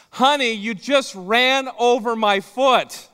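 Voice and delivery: loud, high, slow cry of pain from the wife; pained wife's cry. Retold or imitated. Wife in the drive-thru, foot run over, pained loud cry.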